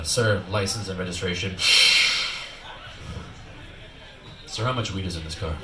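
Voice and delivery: low, gruff mock cop. Comedian imitating the traffic cop, low and stern.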